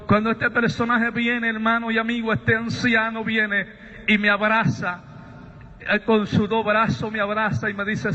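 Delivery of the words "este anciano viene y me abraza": ordinary talk, nothing marked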